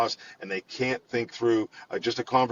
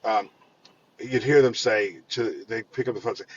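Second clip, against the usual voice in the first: usual speaking voice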